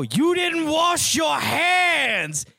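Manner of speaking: slow, high accusing yell; shocked roommate yelling slowly; retold accusing shout. Roommate confronting about unwashed hands.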